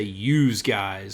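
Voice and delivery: ordinary talk, nothing marked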